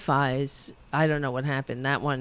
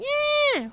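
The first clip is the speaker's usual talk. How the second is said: very high mocking screech; mock yelling. Host imitates the politician screaming outside somewhere, mock yell. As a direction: imitate her with a shrieked, drawn-out yell.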